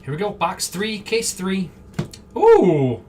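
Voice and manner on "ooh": loud delighted ooh; excited ooh. Real, not acted. Streamer opening a box, thrilled at a card. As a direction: react to a good pull.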